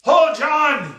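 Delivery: loud, high pirate bellow. Acted. Ghost pirate calls out for the tavern keeper, bellowing. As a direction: bellow across the empty tavern. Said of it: "he bellowed".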